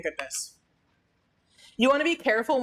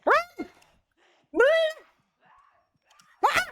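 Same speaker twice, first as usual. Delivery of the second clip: high yelled warning to flee; frantic shout; slower than usual. Real, not acted. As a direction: yell at them to get moving. Fleeing enemy in game.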